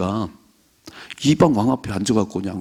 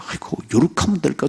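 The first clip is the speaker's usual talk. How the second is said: fretting scheme; 7 semitones higher than usual. Retold or imitated. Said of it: "맨날 내 생각으로".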